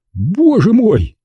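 high, drawn-out exclamation of horror; shocked cry. A narrator's reading. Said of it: "вскричал он".

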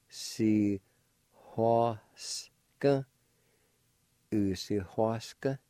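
a faint breathy H before hua in U si hua s k; faint H sound. Shown as a demonstration. Teacher showing students the faint H, syllable by syllable. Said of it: "there'll be a faint H sound like this".

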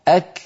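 "أك" with the k a little forward of q; forward kaf. Shown as a demonstration. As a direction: close "أك" on a fronted k. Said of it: "الكاف متقدمة قليلًا".

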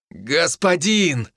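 high, drawn-out joyful cry; happy exclamation. A narrator's reading. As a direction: greet your lord with delight. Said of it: "счастливый возглас".

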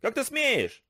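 a mock scream pitched high; bully being dragged off the desk, high yelp. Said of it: "истошно заорал он".

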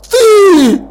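high, loud yell; enraged lord shouting at the commander; shouted accusation. Acted. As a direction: hurl the word at him. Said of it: "выкрикнул лорд Ржав".

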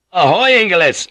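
high-pitched answering hail; calling out. Acted. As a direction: call back across the water. Answering the hail across the sea.

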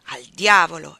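sharp loud curse; a loud burst of frustration; exclamation. Acted. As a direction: blurt it out in frustration. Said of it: "esclamò spingendo".

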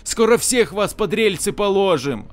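high, defiant answer to the ataman; defiant commissar. Acted. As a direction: throw the threat back, unbowed. Defiant prisoner answers captor.

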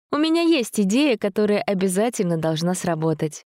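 put-on girl voice. Dasha voiced by narrator.

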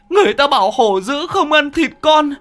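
high, anguished accusing wail; bitter wailing. A narrator's reading. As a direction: howl curses at the mad mother. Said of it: "gào lên chua xót".

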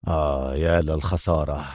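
low, soft sigh of regret; regretful murmur. A narrator's reading. Adham laments the lost scientist, murmuring regretfully. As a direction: murmur it with heavy regret, well down in pitch. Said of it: "مغمغما في أسف".

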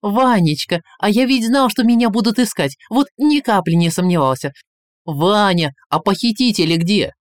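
high, loud, effusive relief; overjoyed. A narrator's reading. Tsar greets Ivan as his rescuer, overjoyed. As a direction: gush excitedly to your rescuer. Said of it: "обрадовался царь".

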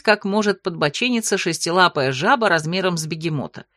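ordinary delivery, nothing marked

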